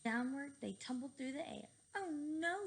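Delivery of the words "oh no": shouted. A narrator's reading. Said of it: "shouted Cheeseball".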